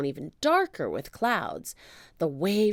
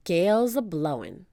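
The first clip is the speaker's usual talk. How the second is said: ordinary delivery, nothing marked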